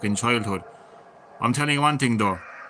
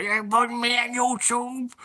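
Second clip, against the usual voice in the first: high, sharp mock-mother snap; mimicking mum's outraged snap; mock snappy mother; 13 semitones higher, 4 dB louder, slower than usual. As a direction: squeal it like an outraged mum. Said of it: "She'll snap if she's hot".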